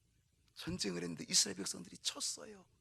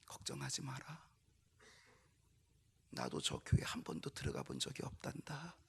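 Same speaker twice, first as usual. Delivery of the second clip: soft, much lower tender voice of Jesus; gentle comfort; 6 semitones lower, 8 dB quieter than usual. Acted. Jesus consoling the turned-away boy, soft and low. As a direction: reassure the crying child quietly.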